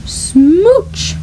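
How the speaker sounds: plain reading voice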